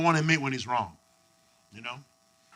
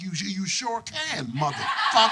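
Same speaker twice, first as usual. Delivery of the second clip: slow smug drawl; slower than usual. Retold or imitated. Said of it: "I'm like".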